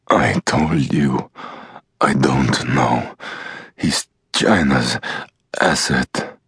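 slow, lightly voiced foreign captive; a hint of a defiant prisoner, slowly; slow, understated Iranian captive; Buta's voice. Captive Buta voiced by narrator.

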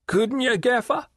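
high, sly leering call across the water; leering waterman. Acted. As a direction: Riderhood hailing Gaffer with a sly leer, pitched high. Riderhood's voice held.

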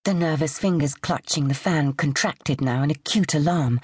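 ordinary delivery

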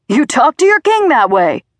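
high, loud, furious bellow; shouting. A narrator's reading. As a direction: shout it as an outraged self-styled king. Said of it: "Dad shouted".

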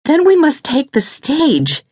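high, slow, insistent woman's voice; voice rising. A narrator's reading. Lydia insists to Reese on the stage, voice rising. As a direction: raise the pitch and insist. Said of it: "her voice rising".

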